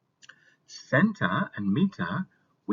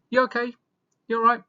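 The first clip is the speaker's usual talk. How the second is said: high, loud, chirpy "You okay? You all right?"; friendly British greeting; 12 semitones higher, 6 dB louder, faster than usual. Shown as a demonstration. Teacher acting a friendly British greeting, high and loud. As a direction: greet a mate brightly, way up high. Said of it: "We might say".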